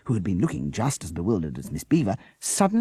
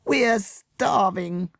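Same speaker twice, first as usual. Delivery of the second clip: wailing; 4 dB louder, much slower than usual. A narrator's reading. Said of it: "wailed the children".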